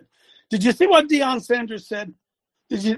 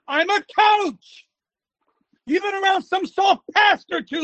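yelling; 6 semitones higher than usual. Real, not acted. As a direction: yell at them like a coach. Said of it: "he does so much yelling".

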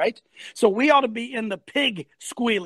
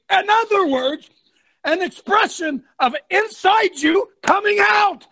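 raised, high, forceful delivery; impassioned; 6 semitones higher, 4 dB louder than usual. Real, not acted. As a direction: build to a loud demand for outrage. Worked-up host demands outrage.

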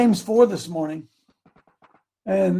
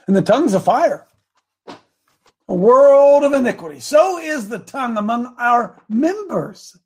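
animated, raised declamation; preaching scripture; 5 semitones higher, 4 dB louder, slower than usual. Acted. Preacher reading James on the tongue, declamatory. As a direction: proclaim it with big peaks and stretched words.

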